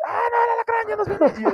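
very high frightened shriek; panicked scream. Retold or imitated. Two friends fleeing the scorpion, high panicked scream. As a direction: scream as if it is chasing you.

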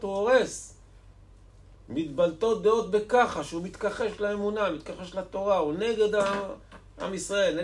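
fervent, high-pitched denunciation; impassioned preaching. Acted. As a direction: build a vehement case, point after point. Preaches at full force.

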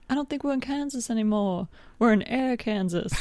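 hammed-up, wistful film-heroine quote. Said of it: "That's not [name]'s voice".

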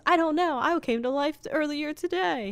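Frosty explaining himself to the traffic cop, innocent; naive Frosty. Retold or imitated. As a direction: answer the cop like a newborn snowman.

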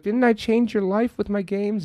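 high-pitched mock plea; mock pleading. Game designer pleading with her would-be killer, high mock plea.

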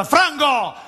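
high, hammed-up boast across the restaurant; mock proud shout. Host calls to the waiter across the restaurant, high proud mock shout. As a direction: show off your guest to the waiter far away.

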